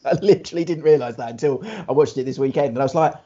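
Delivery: ordinary talk, nothing marked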